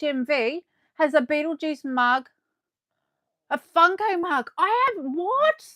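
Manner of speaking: high, loud gasp and disbelief; gasping in disbelief at a friend's find. Real, not acted. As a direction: react as if the news is outrageous. Shocked by a friend's news.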